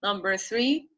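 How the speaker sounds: ordinary talk, nothing marked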